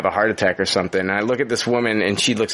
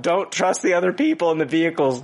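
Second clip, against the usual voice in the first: higher, laughing mock public service announcement; mock PSA announcer; 8 semitones higher than usual. Warning podcast listeners about dead stoplights, mock PSA announcer voice. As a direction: hand down driving advice like a TV safety ad. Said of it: "another fucking public service announcement".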